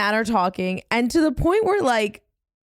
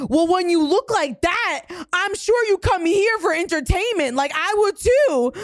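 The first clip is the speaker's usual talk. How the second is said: higher-pitched cutting comeback; retold catty retort; 6 semitones higher than usual. Friend re-enacting comeback to the laughing woman, catty. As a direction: throw the insult back, pitched up.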